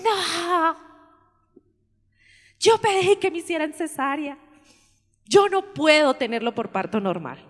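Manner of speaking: high, loud tearful protest; protesting daughter. Retold or imitated. The daughter pleads with the doctor, high and loud. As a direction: daughter crying out she wants a cesarean.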